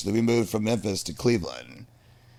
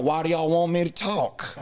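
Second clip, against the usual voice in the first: put-on higher, puzzled Southern kid; thick Southern accent; 5 semitones higher than usual. New kid answering classmates in the hallway, thick Southern accent. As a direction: answer as a bewildered Southern newcomer. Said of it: "I had a very thick".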